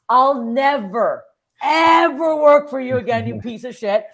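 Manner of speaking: loud, high, drawn-out defiance; retold angry vow. Comic swearing off the club owner who laughed, loud retold fury. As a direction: stretch out each word in indignant outrage.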